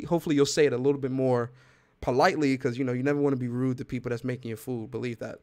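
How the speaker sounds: ordinary talk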